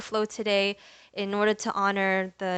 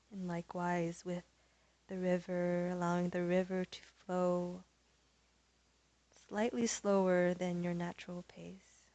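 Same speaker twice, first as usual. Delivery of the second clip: soft, unhurried yoga voice with long pauses; hushed, calm guiding voice, settling softer; guided meditation; 6 dB quieter than usual. Real, not acted. Leads yoga breathing meditation.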